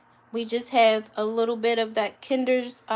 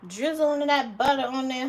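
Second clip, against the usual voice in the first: usual speaking voice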